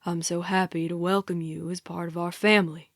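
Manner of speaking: deep, measured man's welcome; Jeremiah. A narrator's reading. Captor welcomes the new captive into the family, low voice. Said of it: "Jeremiah says".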